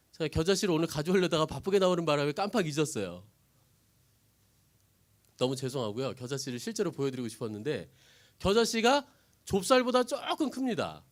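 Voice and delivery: ordinary talk, nothing marked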